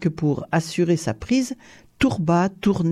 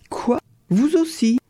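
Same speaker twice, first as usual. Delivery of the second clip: higher-pitched, astonished questions; surprised outburst; 6 semitones higher than usual. A narrator's reading. Tourba learns the count fishes too, surprised. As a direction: blurt it out in surprise. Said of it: "laissa échapper Tourba".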